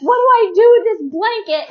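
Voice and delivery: loud, high-pitched mock panic; panicked husband imitation. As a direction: act out a flustered husband's helpless question. Retelling husband's frantic question.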